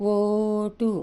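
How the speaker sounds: ఓటు with a long, loud ఓ; long vowel demo. Shown as a demonstration. Teacher contrasting ఒర and ఓటు. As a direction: hold the ఓ in ఓటు. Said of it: "చూడండి, ఇది ఒర".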